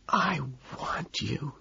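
slow, breathy unvoiced whisper; low whisper. A narrator's reading. Lewis leaning close at the sink, low whisper.

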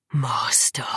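low hiss through hatred. Acted. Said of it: "he hissed".

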